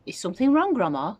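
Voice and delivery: a boy politely pretending concern; polite question. A narrator's reading. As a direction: act all concerned. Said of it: "George asked her politely".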